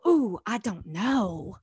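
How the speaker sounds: slow, hesitant "Ooh" of someone scared; anxious hesitation. Retold or imitated. Preacher voicing a person overwhelmed by a calling, slow and hesitant. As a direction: let the doubt stretch out slowly. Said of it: "if it makes you go".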